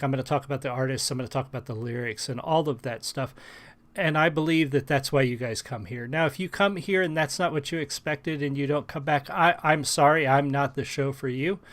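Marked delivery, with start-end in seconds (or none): none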